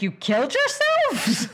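put-on a woman hearing the ghost kids' confession, startled and incredulous; shocked disbelief. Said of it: "She's like".

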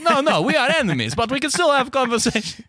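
high, solemn mock Russian accent; earnest Russian man confronting a Brit; high Russian accent, serious.

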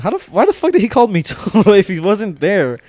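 outraged at a boss who called while absent; incredulous outburst. Retold or imitated. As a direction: blurt it out in shocked confusion. Retold confused outrage.

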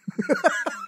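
shrieking laugh at his own joke; squealing giggle; high squeal laugh. Real, not acted. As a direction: burst into a high cackle.

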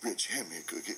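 low, deflated mumble-like delivery; dropped pitch, resigned; acted subdued request. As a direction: imitate a defeated man asking a favour. Defeated driver asks again, acted.

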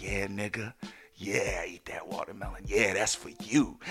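low, soft, half-whispered taunt; mocking look voiced. Couple silently taunts the man, low mock whisper. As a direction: sneer it under your breath. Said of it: "they kinda looked at me like".

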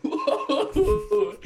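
high laughing whoa, amused. Real, not acted. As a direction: cackle out a shocked whoa. Mock shocked whoa with laughter.

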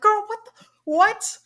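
high, loud disbelieving friend; hammed-up scandalized yelp; mock outrage. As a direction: explode at your best friend in mock disbelief. Acted outrage at friend's texting ex.

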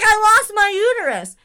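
high loud distressed cry; panicked wail. Retold or imitated. Daughter insists to her dad, loud and panicked. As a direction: insist in horror. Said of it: "She's like".

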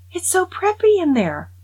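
mock teen gushing in awe; gushing girl. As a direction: swoon over the boutique like a giddy girl. Girl declares entering boutique.

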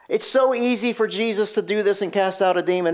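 usual speaking voice

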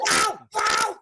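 high, loud crying wow; mock excited shout. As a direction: yell it like a dork. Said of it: "You open it, go".

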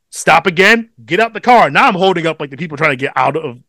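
ordinary talk, nothing marked

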